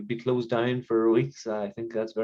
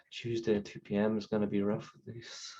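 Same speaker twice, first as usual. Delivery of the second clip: quieter, sighing and drained; weary sigh; 10 dB quieter than usual. Real, not acted. Therapist alone after the call, weary muttered dread. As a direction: dread the next session under a sigh.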